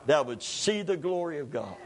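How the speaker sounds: low, soft, calm reply. Retold or imitated. Jesus answering the doubters at the tomb, calm. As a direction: say it quietly and evenly. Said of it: "Jesus calmly looks back at them".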